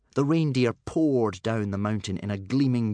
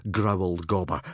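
ordinary delivery, nothing marked

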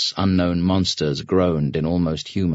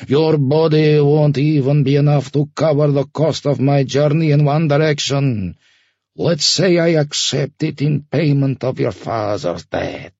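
high, forceful ferryman voice, dropping on the last word; contemptuous loud threat; 8 semitones higher, 4 dB louder than usual. Acted. Ferryman naming his price to Sasha, loud contempt.

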